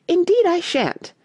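high, slightly indignant refusal; impatient outburst, pitched high; rather impatient. Acted. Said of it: "rather impatiently".

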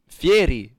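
slow, drawn-out Fieri as "fee-EH-ree"; insistent Italian pronunciation. Shown as a demonstration. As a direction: say Fieri slowly, Italian-style. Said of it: "I'm Italian".

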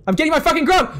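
high, loud defiant yell on "grub"; determined shout. Real, not acted. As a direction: shout "I'm getting my fucking grub" as a battle cry.

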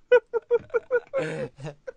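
high-pitched helpless giggle; squeaky laughing fit; helpless laughter. Real, not acted.

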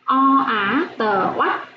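slow teacher spelling; spelling out syllables. Shown as a demonstration. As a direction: sound out O, á, tờ, then blend into oắt. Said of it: "Cô đánh vần và đọc trơn".

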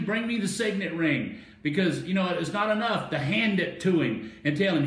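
high, excited preacher with bursts of laughter; fired-up preaching. Real, not acted. Preacher delighting in the ring given to the son, fired-up preaching. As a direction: revel in the story as you preach. Preacher carried away by the story.

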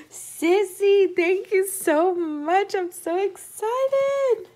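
high, loud, drawn-out thank-you; excited thanks. Real, not acted. Thanking sister for handmade gifts, loud and overjoyed. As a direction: gush your thanks to her.

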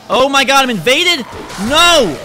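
loud, high wailing dismay; high-pitched panicked shouting; real panic. Real, not acted. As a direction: yell in horror at an intruder. Another player invades the game.

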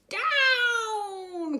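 high, loud shout of "Down!"; the witch commands the broom down; shrill call from a witch steering her broom; witch's cry. A narrator's reading. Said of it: "cried the witch".

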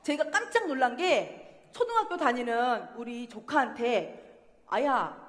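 ordinary delivery

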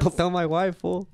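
exaggerated Mexican accent, breaking into a laugh; put-on Mexican accent. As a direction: play up a mock Mexican accent. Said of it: "I just sounded Mexican".

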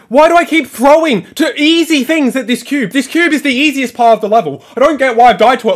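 high, agitated complaining; real frustration at throwing easy parts; exasperated rant. Real, not acted. Rants at own repeated failure.

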